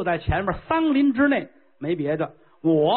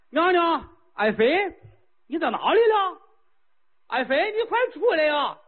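calling out; 7 semitones higher than usual. Acted. King calling his consort.

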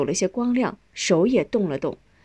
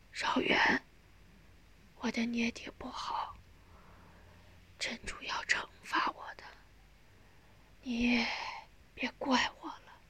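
whispered plea, broken by pauses; faint dying voice; 4 semitones higher, 14 dB quieter than usual. A narrator's reading. Dying mother asks son's forgiveness, faintly. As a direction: plead with hardly any breath left.

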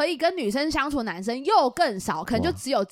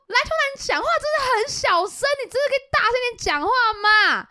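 high, loud mock scolding; a friend scolding the basketball guy, loud and nagging; 12 semitones higher, 4 dB louder than usual. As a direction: nag him to speak up. Scolding him to speak louder.

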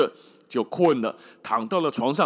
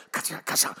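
whispered scissor snips; faster than usual. Acted. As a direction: breathe out the clack of the scissors. Scissors cutting again.

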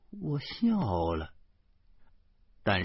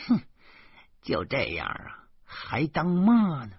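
scornful; 5 semitones higher than usual. A narrator's reading. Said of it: "老妈...补了一句".

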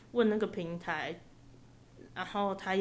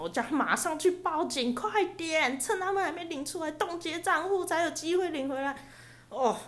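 urgent urging; 8 semitones higher than usual. Retold or imitated. Urging cousin to report.

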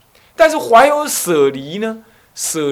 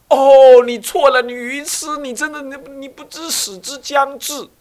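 condescending scolding; 7 semitones higher, 6 dB louder than usual. Retold or imitated. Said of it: "那么你说".

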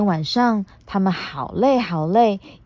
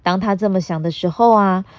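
ordinary delivery, nothing marked

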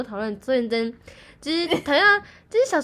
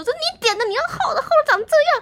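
high-pitched scolding mom, mock-angry; 14 semitones higher, 4 dB louder than usual. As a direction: imitate mom snapping about the thick toast. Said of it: "然后我妈生气说". (模仿妈妈生气地高声责骂)

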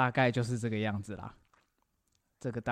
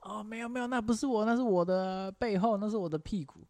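panicked high denial; 9 semitones higher than usual. Retold or imitated. Retold panicked denial in story.

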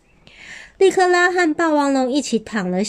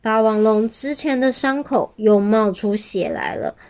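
usual speaking voice

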